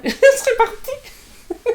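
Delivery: high, laugh-broken words. Real, not acted. Guest amused at how she would have fled, laughing as she speaks. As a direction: say it through a real laugh. Laughs at her own escape.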